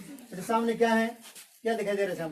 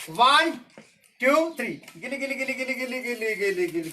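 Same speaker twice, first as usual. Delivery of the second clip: put-on kids party magician; 4 semitones higher, 7 dB louder than usual. Magic trick incantation.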